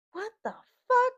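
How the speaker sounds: very high, loud, drawn-out disbelief; incredulous outburst. Retold or imitated. Reacting to passenger's bad Spanish, high incredulous squeal. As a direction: squeal it slowly in mock disbelief.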